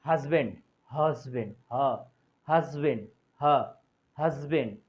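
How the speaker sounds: हस्बैंड said slowly with a clear breathy h at the start; h pronounced. Shown as a demonstration. Teacher models हस्बैंड for the class. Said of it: "उसके आगे अ का प्रयोग करना है".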